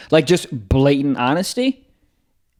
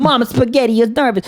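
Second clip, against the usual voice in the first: half-serious rapper parody, pitched high; 7 semitones higher than usual. Acted. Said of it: "Sounds like fucking [name]'s Bodyguard".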